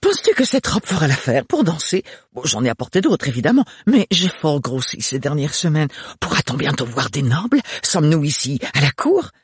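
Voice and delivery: high, excitable chatterbox; gushing sister. Acted.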